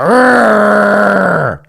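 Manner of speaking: long, loud, high rolled R; exaggerated rolled R. Shown as a demonstration. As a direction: hold out an overdone R, loud and high. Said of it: "like when you say R's, you go".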